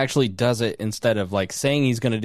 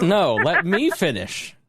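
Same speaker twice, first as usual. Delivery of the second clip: slow mock insistence; 5 semitones higher, slower than usual. Mock-quoting the caller's catchphrase.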